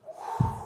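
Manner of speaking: voiceless puff of breath; blowing out. Acted. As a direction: blow like wind scattering stars. Raven blows stars away.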